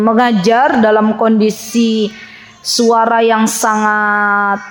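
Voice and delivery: ordinary talk, nothing marked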